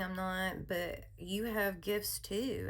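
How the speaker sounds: normal talking voice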